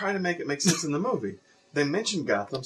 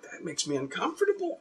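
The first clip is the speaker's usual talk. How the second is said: small, soft squeamish voice, pitched up; uneasy; 4 semitones higher, 9 dB quieter than usual. Acted. Imagined viewer reacting to an awkward scene, uneasy. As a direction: say it meekly, as if squirming.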